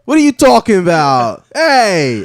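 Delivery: loud, high, hammed-up Boston accent; Boston pigeon. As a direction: holler like a brash Boston bird. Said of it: "Like a Boston pigeon just says".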